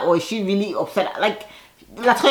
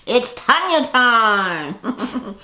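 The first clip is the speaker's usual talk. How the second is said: very slow, higher, laughing sing-song; laughing drawl; 5 semitones higher, much slower than usual. Real, not acted. Vlogger gloating over Tanya, laughing drawl. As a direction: savour it with a laugh.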